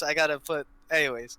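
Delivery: normal talking voice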